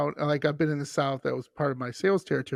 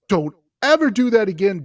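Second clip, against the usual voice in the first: loud, raised-pitch scolding; retold emphatic telling-off; imitated stern warning; 4 semitones higher, 8 dB louder than usual. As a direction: warn them emphatically, as a worried local. Hotel lady warning the traveler.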